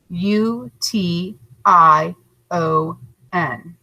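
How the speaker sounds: letters spelled out slowly, one by one; slow spelling. Shown as a demonstration. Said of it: "write the word pollution".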